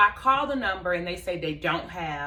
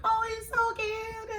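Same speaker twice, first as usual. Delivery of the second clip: put-on much higher, slow cooing over a cute toddler; cooing onlookers; slower than usual. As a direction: gush like a stranger charmed by a baby. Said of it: "Everybody's like".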